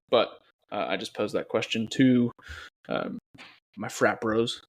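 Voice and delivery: usual speaking voice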